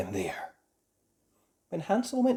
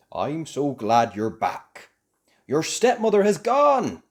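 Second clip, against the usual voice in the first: slow, louder glad father; a father welcoming them home; relieved father; 3 semitones higher, 8 dB louder than usual. A narrator's reading. Father greeting his returned children, slow and glad.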